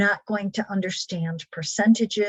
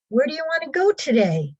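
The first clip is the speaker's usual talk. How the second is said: bright question; 6 semitones higher, 3 dB louder, faster than usual. Retold or imitated. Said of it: "Turn questions into answers".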